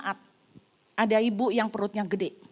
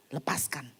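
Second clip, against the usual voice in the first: whisper; 8 semitones lower, 8 dB quieter than usual. Retold or imitated. Smith's command, hushed.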